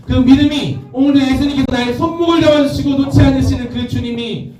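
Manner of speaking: high, sustained closing exhortation; preacher's high-pitched build; fervent preaching. Real, not acted.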